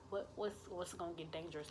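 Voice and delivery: very soft, stumbling over the question; hushed confusion. Retold or imitated. Teller retells hearing the man's warning, hushed and confused. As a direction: puzzle it out under the breath.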